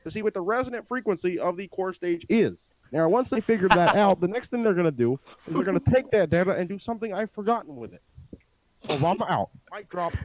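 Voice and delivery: measured presidential impression; halting statesman cadence; Obama. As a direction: explain it like a speechifying president, with pauses. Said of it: "explain this whole thing like Obama".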